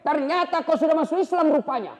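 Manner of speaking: high, loud accusation; accusing shout. Acted. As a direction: throw the accusation at her. Umar discovers conversion.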